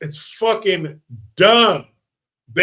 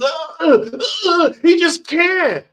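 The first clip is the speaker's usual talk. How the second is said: choking guy; 11 semitones higher than usual. Retold or imitated.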